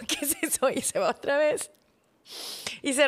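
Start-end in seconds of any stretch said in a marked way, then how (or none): 0.1-0.7 the question again through a laugh; laughing amazement. Retold or imitated. Wife replaying husband's wonder, now laughing. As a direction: giggle while asking it. Same quote repeated with laugh.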